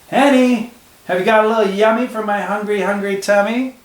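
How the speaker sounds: high, sing-song little tomten voice; tomten calling. A narrator's reading. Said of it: "The tomten always called out to her".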